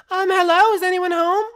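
high falsetto, polite call; princess calling in. Acted. Princess at the door, high hesitant hello.

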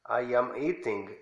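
"eating" with a crisp T, just as it is written; pronounced as spelled. Shown as a demonstration. Teacher repeating the foreign classroom version, "eating" with a hard T. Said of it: "allá lo pronuncian como se escribe".

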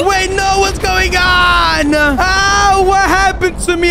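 high, panicked yelling at the change; panicked shout. Acted. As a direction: shout in alarm, voice jumped high. Shocked at sudden transformation.

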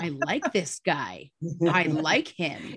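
savouring approval. Retold or imitated. Patient won over by her blunt psychiatrist, approving. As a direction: relish each word, impressed.

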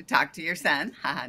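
ordinary talk, nothing marked

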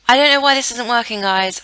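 real annoyance at a broken game; exasperated. Real, not acted. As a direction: blurt it out, fed up with the game. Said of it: "I'm so annoyed right now".

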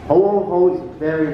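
ordinary talk, nothing marked

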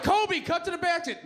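mock coach yelling at Kobe; coach shout. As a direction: yell across the court.

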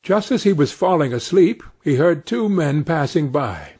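ordinary delivery, nothing marked

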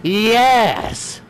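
high, loud cheer; celebrating a win; triumphant yes. Real, not acted. Triumph at success.

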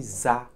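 za, a buzzed z joined to a; z plus a. Shown as a demonstration. Teacher drilling the linking sound. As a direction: say za as one linked syllable. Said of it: "which is Z sound".

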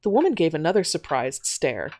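ordinary delivery, nothing marked

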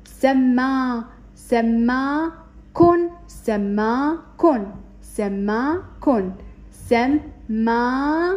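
سماكن drawn out very slowly, syllables stretched; very slow blending. Shown as a demonstration. Teacher blending سماكن slowly for first graders. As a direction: stretch سماكن out, then let it settle. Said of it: "فهنا راح نطبقها مقطع كامل".

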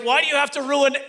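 high-pitched mock complaint from a fed-up wife; imitated wifely reproach; exasperated wife. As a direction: scold him as the exasperated spouse. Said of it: "she's like".

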